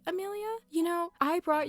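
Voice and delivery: a high, pointed call of the name; indignant call. Acted. Calling out Amelia for siding against her, high and indignant. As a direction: squeak the name out in disbelief.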